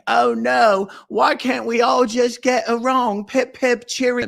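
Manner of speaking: high, loud hammed-up posh Englishman; mock posh Brit. Mocking Alex as a high loud cheery Brit. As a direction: play a chirpy twit. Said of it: "hear Alex be like".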